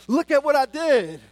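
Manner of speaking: put-on high, loud little-kid brag; proud kid voice. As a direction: chirp it like a proud small child. Kid voice in illustration.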